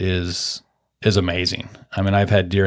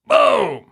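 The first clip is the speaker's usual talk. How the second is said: imitated gunshot; 6 semitones higher, 4 dB louder than usual. Gunshot going off in story.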